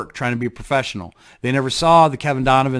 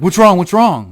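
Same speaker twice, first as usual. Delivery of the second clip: louder, higher mock nagging; nagging what's wrong; 6 semitones higher, 8 dB louder than usual. Friends pestering him at the bar, a mock-insistent louder nag.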